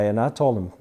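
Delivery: ordinary talk, nothing marked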